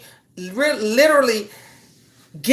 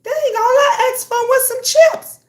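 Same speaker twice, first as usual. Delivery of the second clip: put-on much higher child's complaint; high, hurt child voice; whiny kid; 12 semitones higher, 3 dB louder than usual. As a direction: pout like a hurt little kid. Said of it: "the kid be like".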